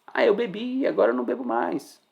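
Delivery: light, high mimicking voice. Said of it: "só fala assim".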